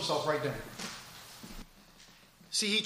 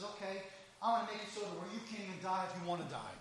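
much softer, fast, ominous as God; quiet God's voice; 14 dB quieter, faster than usual. Acted. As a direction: hush it down, a chilling promise. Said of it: "God says".

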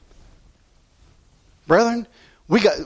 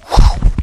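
loud whooshing "whew" of a thrown spear; javelin whoosh; 8 dB louder than usual. Retold or imitated. Preacher acting out Saul's javelin flying, loud whoosh. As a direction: blow out the spear's flight sharply.

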